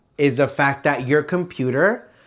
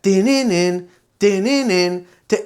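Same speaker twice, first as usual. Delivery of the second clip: imitated phone ring; 3 semitones higher than usual. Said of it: "And it was like".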